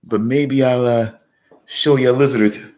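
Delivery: high, excited Australian wildlife-host voice, mock Australian accent; hushed Australian nature-show host stalking his prey; crocodile hunter impression. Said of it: "I gotta do my crocodile hunter".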